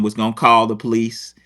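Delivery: ordinary delivery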